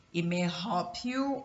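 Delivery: ordinary talk, nothing marked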